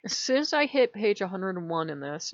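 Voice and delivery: ordinary talk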